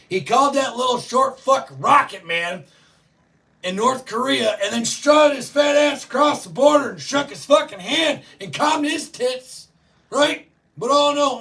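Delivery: loud, high ranting shout; heated rant, voice up and loud; furious shout. Real, not acted. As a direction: yell it out in full outrage. Ranting at Trump's critics.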